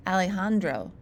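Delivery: ordinary delivery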